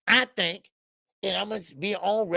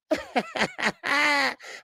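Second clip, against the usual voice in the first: giddy laugh; 7 semitones higher than usual. Retold or imitated. Voiced fighter laughing giddily.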